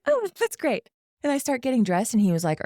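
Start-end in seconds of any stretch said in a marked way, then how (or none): 0.1-0.9 much higher, sing-song fake cheer; mock breezy. Brushing off the guy after sex, high mock cheer. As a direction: toss it off brightly, not caring. Mocking breezy reply to him.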